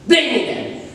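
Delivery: a hurt laugh daring them; defiant laugh. Acted. Narrator challenging the listener, bitter laugh.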